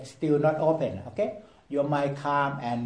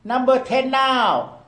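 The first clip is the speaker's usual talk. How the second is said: loud, high airport call with drawn-out words; gate announcer; 9 semitones higher, 6 dB louder, slower than usual. Acted. Airport staff calling passengers to gate ten, loud announcing voice. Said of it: "they just call your name".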